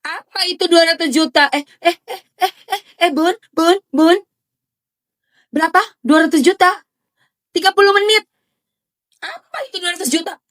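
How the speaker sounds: high-pitched mock disbelief; incredulous mock. Streamer mocking the ex's money demand, high incredulous. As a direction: act out baffled disbelief at the sum.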